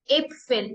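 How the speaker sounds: Äpfel with an open eh vowel; German umlaut Ä. Shown as a demonstration. Teacher demos umlaut Ä for students: Äpfel with eh. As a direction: say Äpfel starting on eh. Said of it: "pronounced as A in German".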